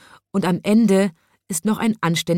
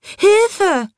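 high, loud cry for help; panicked shriek from the zebra; zebra screaming; 6 dB louder than usual. A narrator's reading. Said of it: "schrie in diesem Augenblick das Zebra".

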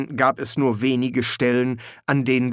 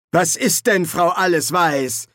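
put-on higher, louder young wizard calling; Ponder calling out; 6 semitones higher, 4 dB louder than usual. A narrator's reading. Ponder calls to the housekeeper amid flying spells, raised voice. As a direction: call across to her over the noise. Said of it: "rief Ponder".

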